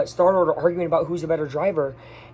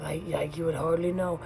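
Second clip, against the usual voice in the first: much softer, choked-back words; barely getting it out; 9 dB quieter than usual. Retold or imitated. Star-Lord answering Gamora, barely able to speak, much softer. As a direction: force the words out quietly, holding back feeling. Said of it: "He can barely get it out".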